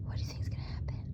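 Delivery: hushed whisper; secretive whisper; suspenseful whisper. Acted. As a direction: lean in and whisper the question. Suspense aside to child listeners.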